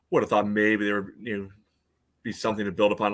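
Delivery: usual speaking voice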